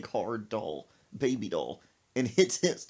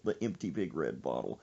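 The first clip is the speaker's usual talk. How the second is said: usual speaking voice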